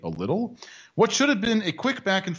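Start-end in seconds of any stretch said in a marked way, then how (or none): 0.0-0.6 dry, mocking "a little"; lower, wry "a little", rising at the end; sarcastic aside. Shown as a demonstration. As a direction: sneer the words "a little". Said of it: "I add parenthetically".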